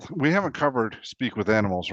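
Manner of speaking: normal talking voice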